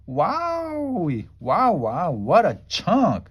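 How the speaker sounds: high wowie, then slow admiring; a girl smitten at first sight; swooning. A narrator's reading. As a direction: gush over a cute boy. Swooning over handsome boy.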